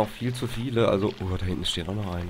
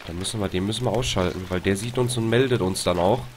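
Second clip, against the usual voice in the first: normal talking voice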